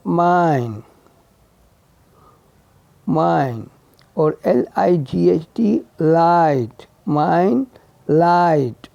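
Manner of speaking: ordinary delivery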